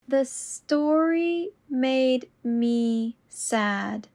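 very slow and deliberate. Shown as a demonstration. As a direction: stretch each word out. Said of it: "repetition drill, slowed take".

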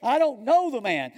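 loud, high, emphatic denial; exclaimed denial. Acted. Peter denies Jesus to bystanders, loudly emphatic. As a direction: blurt it with an exclamation point. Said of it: "there's an exclamation point there".

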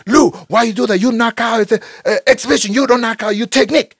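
put-on loud, high Thai-accented coach scolding. Thai coach scolding his fighter for the knockout, loud in a Thai accent. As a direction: yell it in broken English like an angry Thai trainer. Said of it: "he was a Thai guy".

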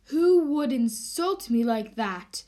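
slow, loud indignant question; offended indignation. Acted. The mayor is insulted by the bump, loud and slow. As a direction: draw it out, affronted.

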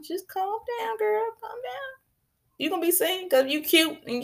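calm a friend down; gentle calm down, girl; soothing. Acted. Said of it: "reassuring a friend".

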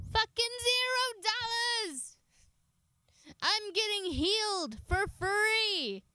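high, slow, gleeful cry; elated outburst. Real, not acted. Vlogger thrilled the surgery costs nothing, high gleeful cry. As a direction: squeal it out in disbelief at the price.

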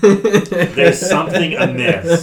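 words breaking into a laugh. Real, not acted. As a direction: laugh through the line. Friends joking about mass murder.

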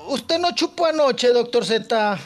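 ordinary talk

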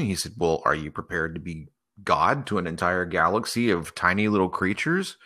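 usual speaking voice

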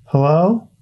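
high, distant call up a well shaft; echoing voice from the well. Acted. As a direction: voice calling down from above, high and hollow. Ghost-like voice from the well.

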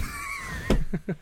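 very high screaming burst of laughter; shrieking laugh. Real, not acted.

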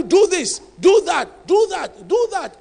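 loud, punched orders, each do hit hard; a coach barking instructions at his player; commanding coach. Retold or imitated. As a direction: drill it like a coach giving orders. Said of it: "a coach who will tell you".

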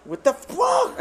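very high mock English exclamation; high shocked English line; mock Gus. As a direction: squeal it in surprise. Imitating Gus.

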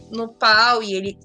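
ordinary delivery

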